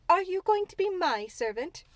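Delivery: haughty little demand; imperious. A narrator's reading. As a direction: ask it like she owns the place. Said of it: "still in her imperious little Indian way".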